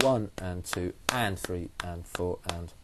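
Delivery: slow counting. Shown as a demonstration. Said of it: "That's where the accents will come".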